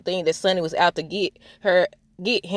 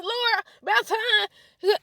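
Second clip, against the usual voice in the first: high, slow, drawn-out about time; finally pleased with Laura; approving drawl; 11 semitones higher, much slower than usual. Retold or imitated. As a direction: stretch it out approvingly. Praising Laura.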